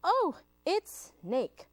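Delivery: mouse greeting. Acted. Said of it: "said Mouse".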